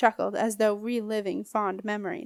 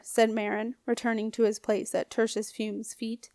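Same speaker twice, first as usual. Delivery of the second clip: ordinary delivery, nothing marked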